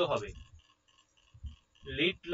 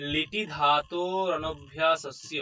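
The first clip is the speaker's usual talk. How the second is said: slow recitation; slower than usual. Shown as a demonstration. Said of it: "teacher recites sutra slowly".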